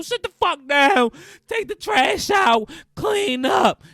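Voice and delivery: bellowed household orders, high and loud; booming boss-of-the-house yell; commanding shout. Acted. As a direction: shout slow, drawn-out commands at the family. Acted assistant principal ordering the household.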